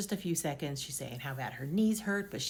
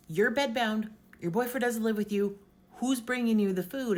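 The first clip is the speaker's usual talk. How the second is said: stern doctor; 6 semitones higher, 5 dB louder than usual. Retold or imitated. Doctor confronts patient about food.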